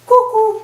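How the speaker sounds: high, loud shrieked cuckoo call. Acted. Professor greeting new students with a loud high cuckoo call. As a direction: let out a bird's cuckoo at the top of the voice. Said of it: "What's with the cuckoos?".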